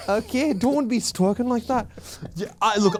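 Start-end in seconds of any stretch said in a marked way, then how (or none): 0.1-1.9 higher, slow Irish lilt; mock-scold in an Irish brogue; Irish accent. Joining co-host's accent bit, Irish accent. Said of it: "I love the Irish accent".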